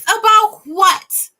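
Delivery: drawn-out sarcastic disbelief; mocking incredulous squeal; sarcastic challenge. Real, not acted.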